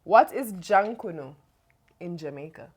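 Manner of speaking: ordinary talk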